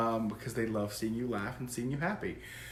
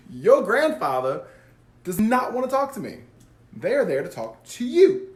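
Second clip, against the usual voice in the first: playful loud sass; 7 semitones higher, 7 dB louder than usual. Real, not acted. Sassy aside to viewer Graham.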